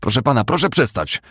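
high, loud plea to stop; insistent pleading. A narrator's reading. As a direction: urge him desperately to stop. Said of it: "nalegał bezskutecznie strażnik".

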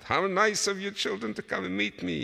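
high, delighted greeting; pleased father. A narrator's reading. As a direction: greet the children with pleased surprise. Father greets children happily.

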